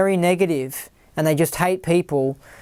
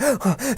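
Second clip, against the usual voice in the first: sharp fearful gasp retold; 7 semitones higher than usual. Startled by a beep while driving, gasp. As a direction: gasp as if startled by a car horn. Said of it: "genuinely scared".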